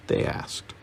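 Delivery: plain reading voice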